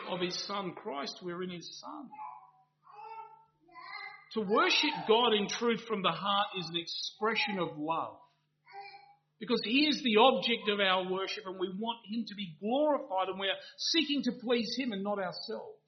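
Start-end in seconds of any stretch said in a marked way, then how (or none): 4.3-15.8 louder, higher impassioned sermon voice; full-force preaching. Acted. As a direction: drive each clause home to the congregation.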